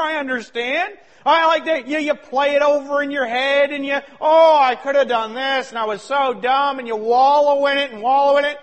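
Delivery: loud, high, driving sermon; full-force preaching. Acted. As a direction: preach it hard to the congregation.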